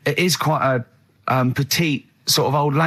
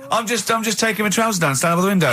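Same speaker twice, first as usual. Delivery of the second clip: lightly voiced Carl, laughing at the end; hammed-up reply to the wife; mock Carl; 7 semitones higher than usual. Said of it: "acting out Carl and Suzanne".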